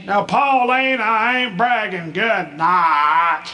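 put-on high, slow modest old man; dad's bashful refusal. Dad telling his wife Pauline he isn't bragging. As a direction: play humble but proud.

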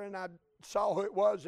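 usual speaking voice

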